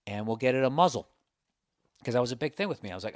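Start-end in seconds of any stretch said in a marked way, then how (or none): 0.1-1.1 lightly voiced pet store guy; a hint of an easygoing shop assistant; store clerk. Petco clerk offers a customer cages, lightly acted. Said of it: "And the guy's like".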